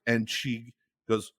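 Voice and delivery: ordinary talk, nothing marked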